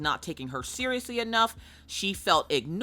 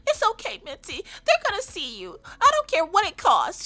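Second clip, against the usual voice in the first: high-pitched mock fussing, building louder; hammed-up baby-the-pet voice turning insistent; doting dog owner; 11 semitones higher, 4 dB louder than usual. Owner comforts Mitzi, then declares, mock frantic.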